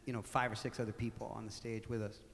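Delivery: usual speaking voice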